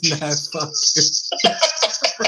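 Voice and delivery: slow, stretched-out mock of his buddy; imitating Brian slowly. Host mimicking co-host's retort, slow and drawn out. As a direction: drag it out like a dopey impression. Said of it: "He says".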